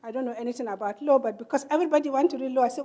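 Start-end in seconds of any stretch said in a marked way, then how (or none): none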